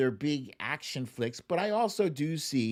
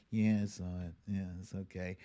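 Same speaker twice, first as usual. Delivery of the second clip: unimpressed reply; 6 semitones lower, 8 dB quieter, faster than usual. Retold or imitated.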